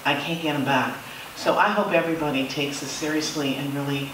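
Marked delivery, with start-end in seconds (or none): none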